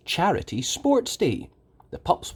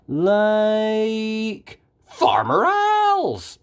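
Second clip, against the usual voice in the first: much higher, slow, drawn-out group cheer; pups cheering; 10 semitones higher, 3 dB louder, much slower than usual. A narrator's reading. Pups cheer to Ryder with a high, drawn-out cry. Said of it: "the pups cheer together".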